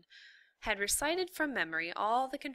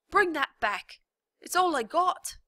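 much higher, louder pleading yell; desperate shout; 10 semitones higher, 10 dB louder than usual. A narrator's reading. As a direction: beg the rat at full voice. Prisoner begs loudly.

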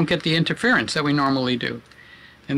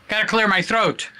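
ordinary delivery

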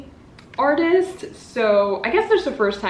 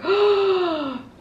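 high gasp; 10 semitones higher than usual. Real, not acted. Seeing cover.

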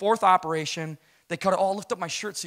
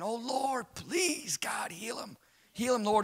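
pleading prayer voice, higher and softer; imitating church prayer; 6 semitones higher, 5 dB quieter, much slower than usual. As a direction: church folks begging God over the hospital bed, high soft pleading. Church praying over dying man.

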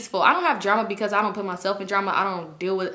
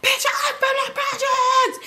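mock scream. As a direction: imitate her screaming it out. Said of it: "always scream how she was from the projects".

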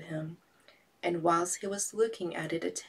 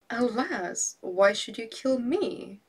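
higher, louder frightened plea; fisherman cries out; 5 semitones higher, 5 dB louder, slower than usual. Acted. Fisherman pleading with the genius not to kill him. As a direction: beg for your life. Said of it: "cried the fisherman".

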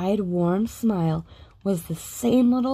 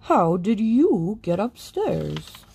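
slow, puzzled watchman; 3 dB louder, slower than usual. A narrator's reading.

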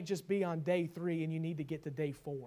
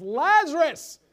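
loud call; 8 semitones higher, 10 dB louder, slower than usual. Acted. Calling Lazarus from tomb.

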